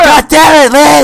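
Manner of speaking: loud high shout; loud sharp voice. Acted. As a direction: yell it in mock rage. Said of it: "why are you yelling?".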